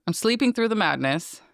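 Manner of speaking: usual speaking voice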